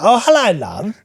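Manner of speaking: high, sweet Cockney greeting; a pretty girl turns round and speaks broad Cockney; mock Cockney girl. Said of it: "that awful Cockney accent".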